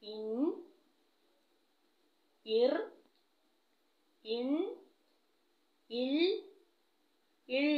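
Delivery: each chillu ending said slowly and clearly, separated by long pauses; chillu sounds. Shown as a demonstration. Teacher drilling young pupils on the five chillu sounds, slow and clear. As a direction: sound out ഇൻ, ഇർ, ഇൻ, ഇൽ, ഇൾ one at a time, each cut short at the consonant. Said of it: "ഏതൊക്കെയാണ്?".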